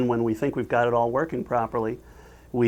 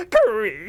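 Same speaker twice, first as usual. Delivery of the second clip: high, drawn-out creaking noise; door creak; 9 semitones higher than usual. Acted. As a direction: imitate a door creaking open. Imitated door opening.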